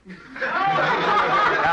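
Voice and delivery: laughing out loud, high; big delighted laugh; high burst of laughter. Real, not acted. Audience broke up, speaker laughs.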